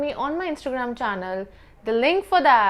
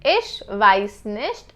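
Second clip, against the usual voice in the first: "Ich weiß nicht" said slowly and clearly; slow demo; 4 semitones lower, much slower than usual. Shown as a demonstration. Teacher slowing the German for students. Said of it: "you can say".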